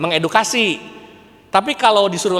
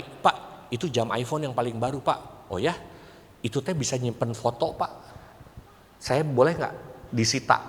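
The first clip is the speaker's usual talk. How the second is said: hushed low whisper; 4 semitones lower, 9 dB quieter than usual. Retold or imitated. Young teacher tipping off a colleague during an exam, whispering. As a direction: whisper so the student won't hear.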